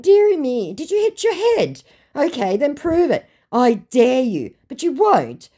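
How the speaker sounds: high-pitched mock concern turning to a dare; taunting. Daring a scammer to prove it, taunting. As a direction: goad them in a high, patronising voice.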